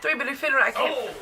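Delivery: fast high auctioneer patter. Retold or imitated. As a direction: rattle it off like an auctioneer. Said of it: "talking like an auctioneer person".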